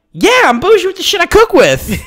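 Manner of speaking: throwing it back at dad in the aisle; mock defiant retort. Retold retort to father.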